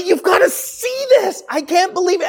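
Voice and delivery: high, slowed, amazed; high eager wonder; excited telling. Retold or imitated. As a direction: share a discovery you can't contain. Telling Arminian friends about election.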